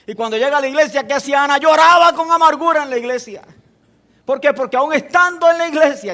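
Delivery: loud, high, driving preacher's voice; full-force preaching. Acted. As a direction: preach it out to the congregation, building. Preaching Ana's story at full force.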